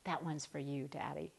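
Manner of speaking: hushed, loving dedication; tender aside to God. Shown as a demonstration. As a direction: say it softly, as a private thank you to Daddy. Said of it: "just go".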